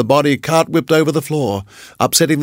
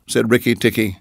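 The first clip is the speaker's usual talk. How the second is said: plain reading voice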